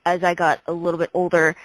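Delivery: ordinary talk, nothing marked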